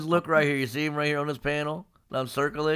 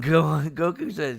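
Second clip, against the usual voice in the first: normal talking voice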